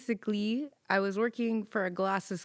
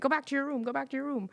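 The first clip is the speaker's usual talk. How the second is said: put-on higher-pitched, firm repeated order like a babysitter; scolding a patient; 6 semitones higher, much faster than usual. Clerk ordering a patient back to her room, firm scolding. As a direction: send the wandering patient back, sternly.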